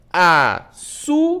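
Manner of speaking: "Açúcar" broken into A, çú, car with gaps; slow, separated syllables on "Açúcar". Shown as a demonstration. Teacher showing pupils an example word, syllable by syllable. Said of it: "Quando eu separo a palavra açúcar".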